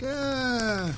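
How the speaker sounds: high, soft, drawn-out "ah"; imitated noncommittal noise. Imitating the listener in complete denial, high soft "ah". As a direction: mimic someone in denial with a high little noise. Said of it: "you're like".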